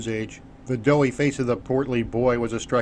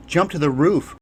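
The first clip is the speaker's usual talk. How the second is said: a raised call, a touch higher; shouted; 4 semitones higher, 4 dB louder than usual. Acted. Carmen calls to Critias over the battle, shouting. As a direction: yell the order over gunfire. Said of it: "Carmen shouted".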